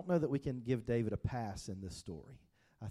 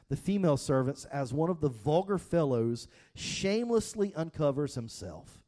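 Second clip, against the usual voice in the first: sarcastic tone; 3 semitones higher, 7 dB louder than usual. Shown as a demonstration. Pastor reading the wife's sarcastic words to the congregation, mocking. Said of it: "read this with a sarcastic tone".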